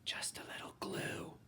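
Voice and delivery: secretive breathless whisper. Acted. Miss Moose sharing her hair secret in a whisper. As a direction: let him in on the secret, softly. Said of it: "winked and then whispered".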